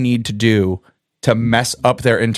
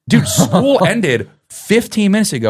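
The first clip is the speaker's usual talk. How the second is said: higher, amused exasperation; exasperated; 7 semitones higher than usual. Retold or imitated. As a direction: spell it out to a clueless kid. Teacher exasperated at student.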